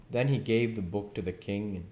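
ordinary delivery

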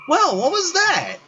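very high, stretched puzzled cry; startled disbelief. Real, not acted. Player reacting to a weird minigame glitch. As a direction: cry out in confusion.

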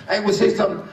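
ordinary talk, nothing marked

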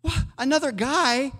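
high, incredulous yelps of protest; a squeaky mock outrage at the news; shocked disbelief. Listener told another redeemer is nearer, squeaking in mock disbelief. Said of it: "And you're like".

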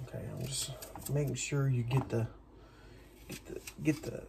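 stumbling over repeated words; stuttering. Real, not acted. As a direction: stutter through the phrase. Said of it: "I don't know why I'm stuttering either".

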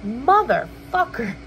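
slow, exasperated retold swear; retold exasperation. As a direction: stretch out the curse in retold frustration. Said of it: "I was, like, getting so annoyed".

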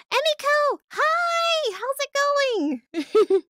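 loud, very high-pitched squealed hello; high, loud burst of delight; excited greeting. Real, not acted. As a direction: squeal hello to a friend who just showed up. Excitedly greeting a viewer who arrives.

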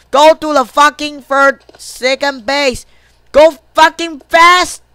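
loud, high yell, slowing to pound each word; frustrated shouting. Real, not acted. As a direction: yell urgently at a runner. Player yells at slow runner.

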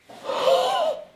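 shocked gasp. Real, not acted. Device says danger.

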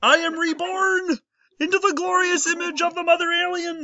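mock-grand, strained high puppet voice; Kermit the Frog. Shown as a demonstration. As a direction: declare it as a reborn puppet frog cultist. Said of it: "the hybrid Kermit".

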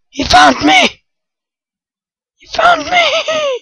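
very high shout, then laughing; high squeal into laughter; high shriek. Real, not acted. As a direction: shriek as you're caught. Caught while hiding.

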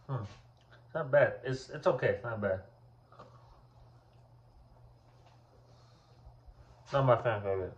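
talking between bites; chewing while talking. Real, not acted. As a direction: mouth partly full of cookie. Seen 1: Bit the cookie at -1.0; the jaw moves while he talks after.

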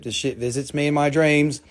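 ordinary talk, nothing marked

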